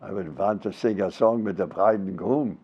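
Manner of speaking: low, soft Yiddish-accented English. Shown as a demonstration. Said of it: "say that with an accent".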